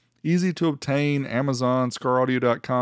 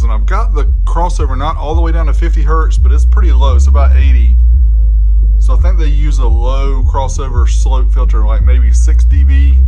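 loud, raised voice over booming bass; talking over loud music; 15 dB louder than usual. Real, not acted. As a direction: raise your voice above the bass so they hear you.

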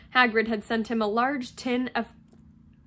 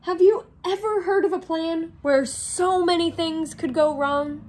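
higher, louder incredulous dismay; doom-laden dismay; 6 semitones higher, 5 dB louder than usual. Acted. Ron turns to Harry about the plan, dismayed. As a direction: foretell disaster in dismay. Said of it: "with a doom-laden expression".

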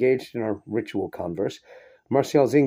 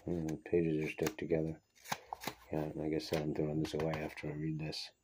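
much softer offhand aside; quiet remark to self about stuck pages; muttered aside; 6 semitones lower, 14 dB quieter than usual. Real, not acted. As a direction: drop out of the story to grumble.

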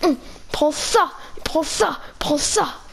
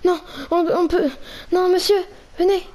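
high, urgent panicked calling; 5 semitones higher than usual. Acted. Player fleeing the Enderman, calling his companion in high panic. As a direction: call him along in frantic alarm.

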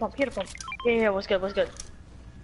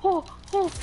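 shrieking jackpot winner; 8 semitones higher, slower than usual. Real, not acted. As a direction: squeal like you hit the jackpot. Gamer shrieking over a big win.

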